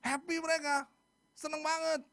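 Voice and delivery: high, elated exclamation; excited. Real, not acted. Preacher describing freed Israel's happiness, excited. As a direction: share the people's delight.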